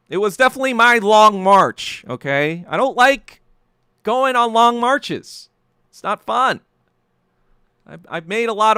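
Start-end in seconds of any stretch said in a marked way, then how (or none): 0.1-6.6 louder and higher, slowing on the last words; exasperated emphasis, raised voice; emphatic. Real, not acted. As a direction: drive it home, dragging out long marches. Emphatic rant peak.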